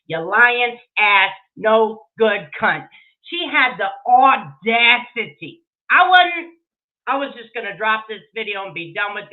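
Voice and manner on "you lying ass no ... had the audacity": loud, angry rant; seething, hammering each insult; real anger. Real, not acted. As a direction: spit it out, fed up and furious. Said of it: "I am fucking pissed".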